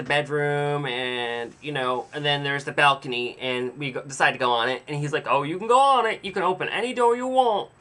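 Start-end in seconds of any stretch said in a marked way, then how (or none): 5.3-7.7 put-on higher, half-hearted Southern accent; attempted Southern accent. Old man welcoming visitors onto his balcony, attempted Southern accent. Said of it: "I can't do a Southern accent either".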